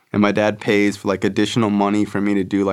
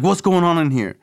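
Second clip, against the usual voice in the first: high, stern mock supervisor; authoritative demand; 9 semitones higher than usual. Supervisor bursting in on fighting kids, stern mock call. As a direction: imitate an officer barging in on a fight.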